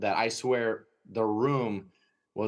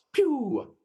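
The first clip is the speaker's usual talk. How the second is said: high, sharp rocket whoosh; rocket sound; 4 dB louder than usual. Retold or imitated. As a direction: zip it out like a launch. Dog shoots off like rocket.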